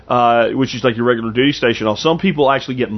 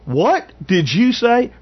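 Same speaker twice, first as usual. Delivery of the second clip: higher, slow drill sergeant demand; 10 semitones higher, slower than usual. Retold or imitated. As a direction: drag it out like a baffled drill sergeant. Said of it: "man, he goes".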